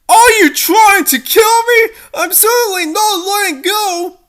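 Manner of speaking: high, loud frightened refusal; panicked shout. Acted. Dangling princess shouts down at her rescuer in panic. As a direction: scream back that you won't let go.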